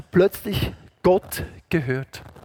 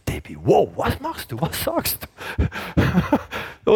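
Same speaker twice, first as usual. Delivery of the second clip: astonished. Retold or imitated. Preacher to wife Debbie, astonished. Retold amazement at wife's act.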